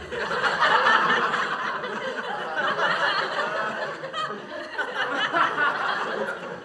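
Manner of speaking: long high-pitched laugh, breaking up; cracking up at a chat joke; big laugh. Real, not acted. Streamer cracks up at a chat joke, laughing high.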